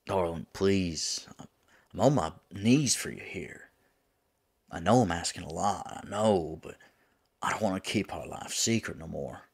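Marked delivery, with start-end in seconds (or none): 0.0-6.6 louder, urgent begging of a lover; desperate pleading. Acted. Demon on knees begging angel darling, pleading. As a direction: implore them, leaning on each plea.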